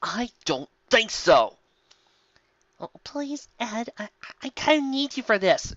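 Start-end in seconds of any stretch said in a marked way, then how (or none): 2.8-5.7 high, soft begging voice; soft high wheedling plea; pleading. Acted. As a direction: coax him, small and high. Pleading author voice.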